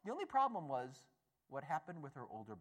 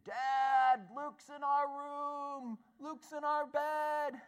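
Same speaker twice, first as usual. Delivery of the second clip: put-on higher, louder, slow drawn-out child's cry for Dad; a kid yelling across the house for his father; child screaming; 12 semitones higher, 8 dB louder, much slower than usual. Older brother yells to Dad about the toddler in his room. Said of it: "We heard the older brother screaming".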